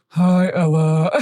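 slow pretend ghost, done by a toddler; child playing the ghost. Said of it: "she pretends to be the ghost".

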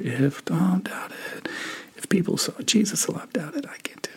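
a secret thought, whispered alone at night. Acted. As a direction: whisper it to yourself in the dark, barely voiced.

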